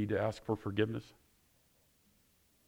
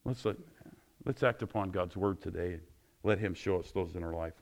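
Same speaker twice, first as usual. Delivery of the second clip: usual speaking voice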